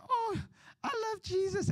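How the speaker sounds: very high mock-girly swoon; gushing party girl. Party girl in tears at the altar, high mock swooning.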